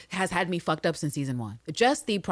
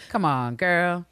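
normal talking voice

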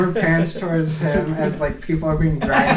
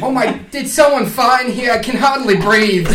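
put-on high, loud kvetching old man; 7 semitones higher, 6 dB louder than usual.

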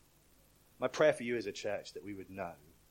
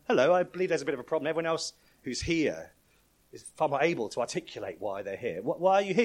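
supervisor angel; 5 semitones higher, 6 dB louder than usual. Retold or imitated. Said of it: "Supervisor angel comes back and goes".

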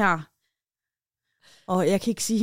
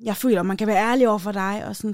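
ordinary talk, nothing marked

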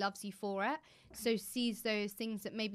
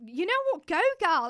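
cheering on; 7 semitones higher, 8 dB louder than usual. Retold or imitated. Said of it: "I was like".

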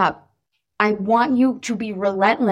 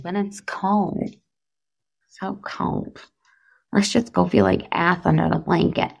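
whiny reluctant complainer; 6 semitones lower, 5 dB quieter than usual. Pretend. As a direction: grumble like you'd rather stay under a blanket. Everybody complaining about cold.

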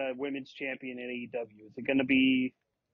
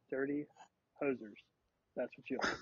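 quiet mutter; 7 dB quieter than usual. Real, not acted. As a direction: mumble it to yourself. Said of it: "Try it again".

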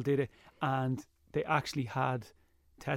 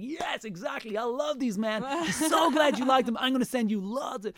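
put-on high, gushing American accent; excited American fans; 10 semitones higher than usual. Mimicking viewers who send him food, excited American accent. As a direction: gush like a thrilled American, pitched way up. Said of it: "Americans, for some reason, get a huge kick".

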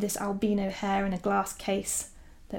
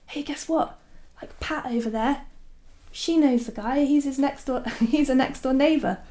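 high, eager, louder imitation of the landlady; excited landlady; 6 semitones higher, 4 dB louder than usual. Landlady telling the visitor that Pat knows the guy, excited. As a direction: burst out with the good news, pitched up.

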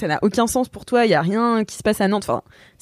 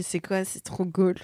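puzzled mutter; 8 dB quieter, slower than usual. Retold or imitated. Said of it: "je fais".

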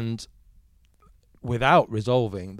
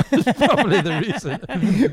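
high, loud, said through laughter; laughing; 10 semitones higher, 7 dB louder than usual. Real, not acted. Guest jokes to interviewer about Australia trip, laughing. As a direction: laugh through your own joking admission. Joking aside, both speakers laughing.

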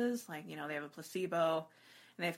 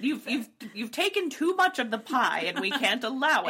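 louder, higher, laughing stern scold; scolding Britain; 5 semitones higher, 8 dB louder than usual. Pretend. Voicing Britain scolding Germany over Poland, loud laughing mock scold. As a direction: wag a laughing finger as Britain.

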